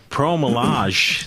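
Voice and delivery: slow "Pro Malage", drawn out with a pause; mocking mispronunciation. Cohost mocks his partner's misreading, slow and drawn out. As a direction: drag out the mangled "Pro Malage". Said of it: "you read a letter and you're like".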